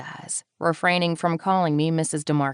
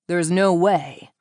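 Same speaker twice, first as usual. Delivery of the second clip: slow, louder, dismissive scoff; 5 dB louder, slower than usual. A narrator's reading. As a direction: brush the idea off slowly and firmly. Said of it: "Lawrence scoffs dismissively".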